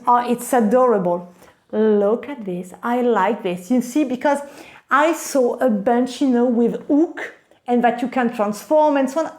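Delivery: usual speaking voice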